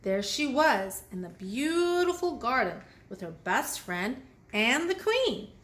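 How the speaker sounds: ordinary delivery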